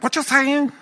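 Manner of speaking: high-pitched mock Chinatown accent. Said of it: "ladies that grow up in, like, Chinatown".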